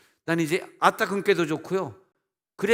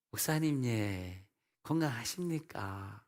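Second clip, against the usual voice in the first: put-on soft, lilting Daegu-accented greeting; 4 semitones lower, 9 dB quieter, slower than usual. Preacher imitating Daegu people greeting their pastor, gentle Daegu accent. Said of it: "대구 언어가 그렇게 친절한지".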